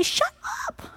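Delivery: dismissive shut up. Shown as a demonstration.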